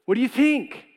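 high, loud, casual hey God; breezy offering. Retold or imitated. Abel cheerfully presenting his sheep to God, casual and high. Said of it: "it wasn't like they didn't know".

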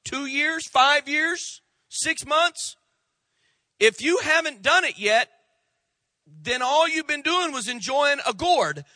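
rising, loud preacher's call that ends in a shout; loud, emphatic pulpit preaching; preaching at full force. Acted. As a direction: drive it home to the congregation.

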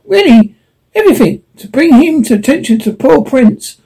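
a small animal fussing over the prince; high animal character voice. Acted.

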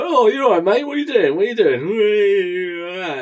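hammed-up Cockney busybody calling out. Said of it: "Like Cockney".

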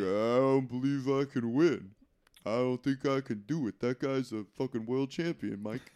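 slurred action-star impression; mock defeated tough guy, dragging the words; Stallone. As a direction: doubt yourself in a thick, sluggish mumble. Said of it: "And then Stallone's like".

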